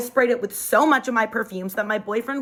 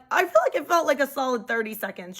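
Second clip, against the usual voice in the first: ordinary talk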